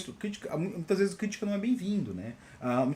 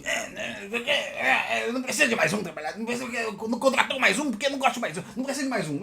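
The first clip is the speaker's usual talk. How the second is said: higher, rushed, put-on grumbling voice; mocking colleague impression; 4 semitones higher than usual. Colleague objecting to the new hire, mocking put-on voice. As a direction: mimic a colleague griping mockingly.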